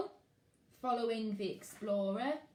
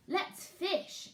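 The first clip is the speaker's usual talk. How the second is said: high eager little voice; small boy; 9 semitones higher than usual. Acted. Max to Fred at the lake, high eager child. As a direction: sound like an excited toddler.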